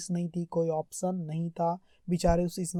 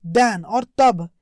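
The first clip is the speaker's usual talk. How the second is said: slow emphatic reading; 3 semitones lower, 6 dB louder, much slower than usual. Shown as a demonstration. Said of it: "slow emphatic reading".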